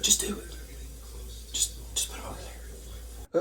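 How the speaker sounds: hushed whisper to himself; soft whispered aside. Real, not acted. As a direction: whisper it under your breath.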